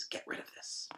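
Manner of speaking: very soft, breathy hiss under her breath; hissed whisper. Acted. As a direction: hiss it so nobody else hears.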